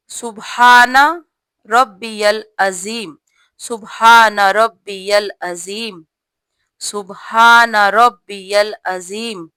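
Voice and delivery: slow, every syllable clear; slow careful recitation. Shown as a demonstration. Teacher showing sisters the correct ruku tasbeeh, slow and clear. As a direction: spell out each word of the tasbeeh slowly. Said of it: "ٹھیک کر کے پڑھنا ہے".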